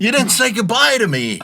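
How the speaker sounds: high, whiny fake complaint; guilt-trip him playfully; mock hurt. Driver teases P J about no goodbye, high mock whine. Teasing player about leaving.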